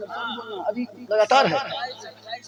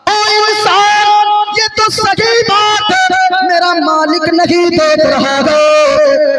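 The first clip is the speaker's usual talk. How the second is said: fervent shepherd; 3 semitones higher, 3 dB louder, slower than usual. Acted. Shepherd's fervent reply in story.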